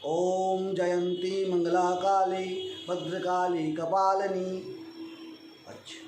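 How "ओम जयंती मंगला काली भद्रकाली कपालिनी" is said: slow, even Om Jayanti Mangla Kali; measured recitation. Shown as a demonstration. Teacher showing the right way: measured pace. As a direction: recite at a steady unhurried pace. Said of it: "अति शीघ्रम पाठ नहीं करना चाहिए".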